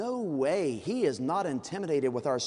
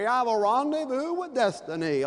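put-on high, loud old-radio orator; pitched-up, loud hammed-up FDR; FDR impression; 7 semitones higher, 5 dB louder than usual. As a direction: declaim like a 1930s president on the wireless. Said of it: "I'll do it like F D R".

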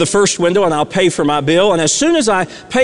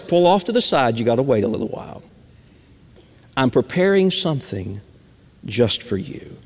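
softer and lower, hushed and tender; God's gentle voice; 8 semitones lower, 6 dB quieter than usual. Acted. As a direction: speak as God, gently hushing an eager child.

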